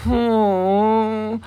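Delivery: high, squealing parody of offended critics; exaggerated shocked gasp, then slow sarcastic finish; mock outrage. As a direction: ham up their indignation like a whiny kid.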